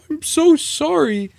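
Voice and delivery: high, slow put-on sad voice; hammed-up sorrowful werewolf; mock mopey werewolf. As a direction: whine it like a pitiful monster. Werewolf voiced in retold story.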